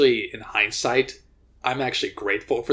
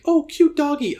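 put-on cute baby talk; 5 dB louder than usual. Imagined naive reaction to wolf.

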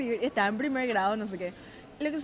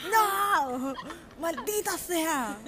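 mock despair; 5 semitones higher, much slower than usual. Reacting to vaccine, comic despair.

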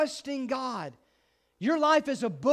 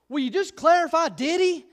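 mock panic; 5 semitones higher, 5 dB louder than usual. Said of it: "went home panicking".

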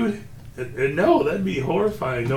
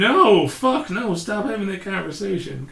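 higher, louder horrified protest; mock horror; 8 semitones higher, 4 dB louder than usual. Reacting to the creepy child story, higher mock-horrified yell. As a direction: recoil and shout it off in comic fright.

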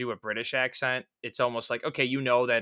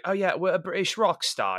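higher-pitched mock British rocker; 6 semitones higher than usual. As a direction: imitate a clichéd British rock star's accent. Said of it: "it's, like, a stereotypical".

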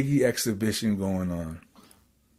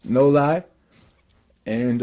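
ordinary talk, nothing marked

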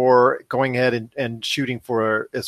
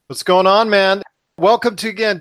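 loud, high hearty welcome; excited; 6 semitones higher, 4 dB louder than usual. Real, not acted. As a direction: greet him with big energy. Greeting guest loudly.

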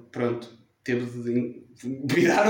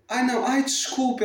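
high, flustered "ai, não"; flustered apology; 11 semitones higher than usual. Retold or imitated. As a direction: play the embarrassed mother, higher. Imita a mãe envergonhada. (mãe envergonhada, voz mais aguda)

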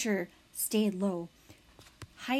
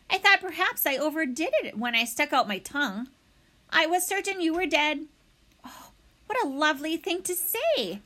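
lightly voiced showy opossum, higher; theatrical opossum; 6 semitones higher than usual. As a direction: ham it up like a proud actress.

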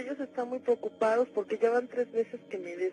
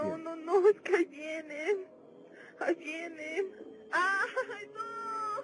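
higher, frantic crying as they come; a scream breaking through the sobs; panicked crying; 8 semitones higher, slower than usual. Acted. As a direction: cry out in terror, pitch climbing. Caller sees the shadows arrive.

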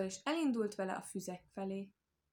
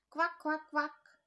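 high, loud quacking croaks; short, high-pitched frog calls; 8 semitones higher, 4 dB louder, much slower than usual. A narrator's reading. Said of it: "hallatszott egyszerre a zsámbékosból".

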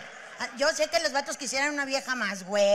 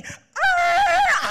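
much higher, loud screech; crash sound effect; 11 dB louder than usual. Retold or imitated. [name] acting out his crash, loud high screech. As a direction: imitate the crash as a shriek. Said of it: "Hasta te hace efectos de sonido".

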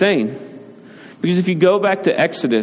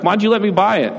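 put-on complaining kid; 6 semitones higher, faster than usual. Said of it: "six minutes later, it's broken".

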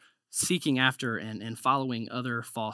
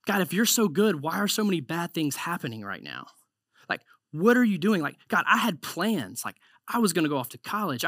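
frustrated plea to God; 3 semitones higher than usual. Retold or imitated. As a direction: vent the disappointment, pitched up. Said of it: "We're like".

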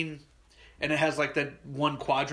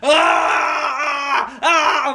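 high, loud hammed-up screams of panic; mock panicked cry; 9 semitones higher, 7 dB louder than usual. Blinded boy to his dad, hammed-up panic. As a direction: yell like a scared blinded kid. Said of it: "I'm like".

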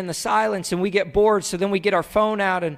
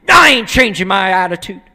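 put-on loud, defiant kid retort; bad attitude; 7 dB louder than usual. Kid refusing dad's correction, bad-attitude retort. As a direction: talk back like a sulky kid. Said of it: "with their bad attitude".

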